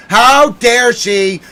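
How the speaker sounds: drawn-out theatrical indignation; mock outraged cry. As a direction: stretch it out in hammed-up outrage. Mock outrage rant, repeat louder.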